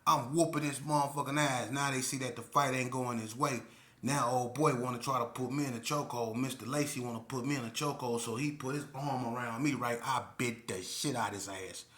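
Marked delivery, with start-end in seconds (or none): none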